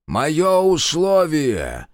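slow, loud, high solemn proclamation; proclaiming. Acted. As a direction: announce it to the heavens. Old god declares his condition. (торжественно, громко)

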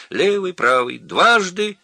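high, loud, bouncy count; squeaky monkey shout. A narrator's reading. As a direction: yell it out while tumbling. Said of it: "кричала мартышка, кувыркаясь".

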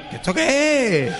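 high, slow, laughing what-is-this; mock neighbour surprise. Neighbours woken by the band, baffled and laughing.

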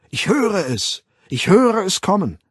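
high, loud chanting cry; rousing high-pitched ecstatic call; chorus roar. A narrator's reading. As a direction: bellow it like a frenzied crowd. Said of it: "im Chor brüllend".

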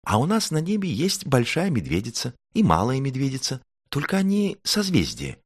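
high, delighted little-star voice. A narrator's reading. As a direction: gush happily in a high child-like voice. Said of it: "обрадовалась Звёздочка".

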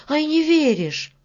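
high, cross challenge; angry girl. Acted. Varya challenges the laughing beetle, angry. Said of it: "рассердилась Варя".

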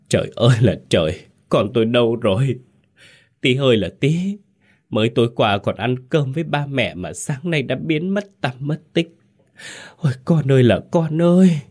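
high, sobbing lament of a mother; anguished wailing. A narrator's reading. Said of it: "gào lên một tiếng thảm thiết, ôm mặt khóc nức nở". (gào khóc nức nở, thảm thiết)

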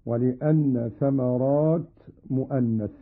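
normal talking voice

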